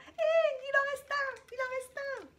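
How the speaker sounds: very high, drawn-out squeal; excited squeal. Real, not acted. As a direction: squeal with delight. Excited find of a product.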